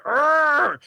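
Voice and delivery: a mock frustrated growl; retold angry arr. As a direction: growl out your annoyance at your partner. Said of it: "And I'm like".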